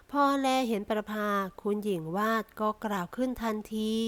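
ordinary delivery, nothing marked

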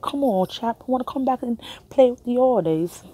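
imagining coming home talking British; mock British accent. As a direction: put on a hammy British accent. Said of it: "I actually pick up their accent".

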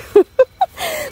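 sudden high-pitched laugh; a quick, bright laugh at the absurdity; high burst of laughter. Real, not acted. Laughing at the fire drill.

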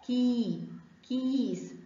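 كي and كيس with a long "ee"; drawn-out "kii, kiis" for كي، كيس; long ya sound. Shown as a demonstration. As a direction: hold the vowel in كي, then say كيس stretched. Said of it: "مد بالياء".

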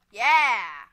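loud, stretched-out cheer; triumphant yeah. Real, not acted. Player cheering a success, loud triumphant yeah. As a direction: shout it in celebration. Celebrates in the game.

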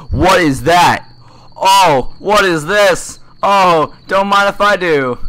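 high, loud giddy exclaiming; thrilled discovery. Real, not acted. Gamer finding rare loot, thrilled. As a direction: gasp over the find with glee.

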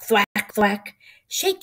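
normal talking voice

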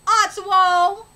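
ordinary delivery

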